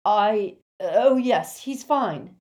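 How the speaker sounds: high, loud forced reply; loudly. A narrator's reading. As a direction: answer too loudly to fill the silence. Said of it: "Lupin said loudly".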